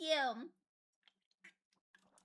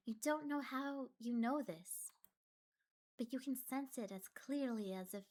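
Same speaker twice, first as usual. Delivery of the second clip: eerie story narration; 7 dB quieter than usual. Acted. Reads horror game narration.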